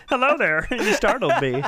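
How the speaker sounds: hammy chirpy Southern greeting; Southern Pee Wee Herman. Acted. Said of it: "getting Paul Rubens to do this".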